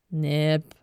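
low warning growl; threatening growl. A narrator's reading. Elf warning the boastful Nip, growling threateningly. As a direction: growl the name as a mild threat. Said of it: "knurrte der vorige Elf drohend".

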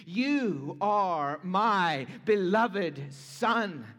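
slow and weighty, each word placed; solemn declaration. Acted. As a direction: proclaim it slowly as the voice from heaven. Voicing God's declaration.

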